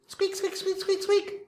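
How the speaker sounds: high, tiny squeaky mouse voice; mouse squeaks. Pretend. Imitating a mouse.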